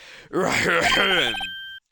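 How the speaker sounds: loud, drawn-out theatrical throat clear; over-the-top throat clearing. Acted. Big boss announcing himself, exaggerated throat clearing. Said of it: "over-the-top clearing of the throat".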